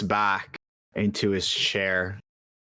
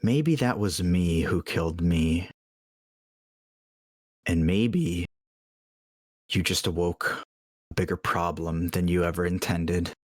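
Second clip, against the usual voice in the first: menacing shapeshifter; 3 semitones lower than usual. Acted. Shapeshifter threatens the adventurer.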